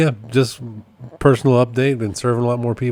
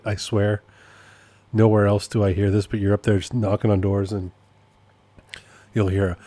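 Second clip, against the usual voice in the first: ordinary delivery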